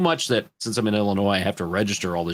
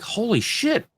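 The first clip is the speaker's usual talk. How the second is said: holy shit; 13 semitones higher, slower than usual. Retold or imitated. Shocked reaction retold.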